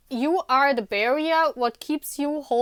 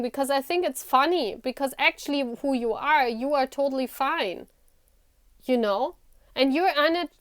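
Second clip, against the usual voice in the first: normal talking voice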